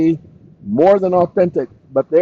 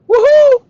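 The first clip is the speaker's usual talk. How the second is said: high, loud woohoo; whoop of joy; 7 dB louder than usual. Real, not acted. Streamer finally finds the file, whooping. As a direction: let out a ringing celebratory whoop.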